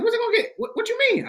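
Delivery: very high mock-mom questioning; alarmed mom. Son imitating mom hearing bad news, high and alarmed.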